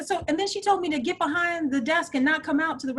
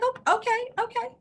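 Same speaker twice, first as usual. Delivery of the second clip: mock sweet; 12 semitones higher than usual. Mock acceptance of an insult.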